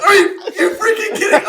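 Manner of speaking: very high, loud screech of disbelief; retold yelling. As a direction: shriek it in comic outrage. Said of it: "I'm, like, yelling".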